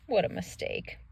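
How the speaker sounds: soft whisper; whispered aside. Real, not acted. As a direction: breathe it out in quiet regret. Aside after Ferdinand is picked.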